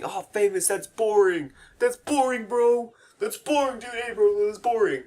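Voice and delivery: high-pitched, loud heckler imitation; needling, high mock protest; mock whining complaint. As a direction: whine like an exaggerated bored heckler. Mocking imagined viewers' complaints.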